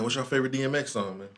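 ordinary talk

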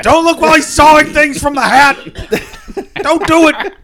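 high, very loud mock warning; panicked movie-hero shout. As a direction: scream the warning in full parody melodrama.

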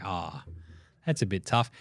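low, soft letdown; imitated quiet disappointment; mock deflated. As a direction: let the air out of it. Acts out deflated reaction afterwards.

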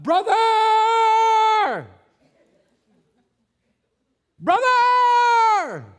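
high, loud yelling across the woods; desperate yell. Retold or imitated. Child shouting for his brother in the woods, frantic yell. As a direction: call out for him at the top of your lungs. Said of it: "So I yelled out".

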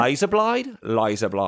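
badly attempted Australian accent, LASER BLADE as "loiza bloide"; hammed-up Australian vowels. Shown as a demonstration. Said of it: "we don't do a very good job".

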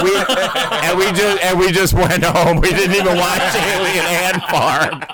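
talking through laughter; laughing. Real, not acted. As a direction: laugh while telling the punchline.

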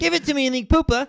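very high put-on Aussie voice; high-pitched Aussie caricature; mock Australian woman. Joins in the Australian woman act.

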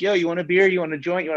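high, loud, eager offer of a beer; party guests pestering the cook, mock-excited and high; hyped partygoer. Partygoers offering him stuff.

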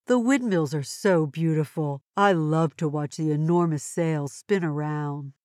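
normal talking voice

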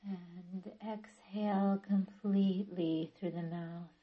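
calm yoga nidra guidance with long pauses; guided meditation. Acted. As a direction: ease listeners into stillness, phrase by unhurried phrase. Leads guided relaxation practice.

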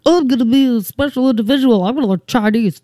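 high-pitched mock-precious voice; mock your younger self's bid to be special. Mocking her younger self's decision.

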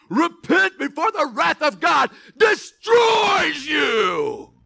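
booming, slow doomsday preacher shout; high, loud mock fire-and-brimstone warning; Jim Cantore impression. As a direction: thunder it out like a storm warning. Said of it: "that's my Jim Cantore".

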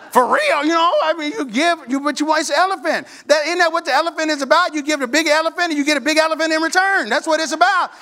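high, fast mock-earnest argument; protesting. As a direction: plead the case like an indignant boy. Boy argues with mother about gifts.

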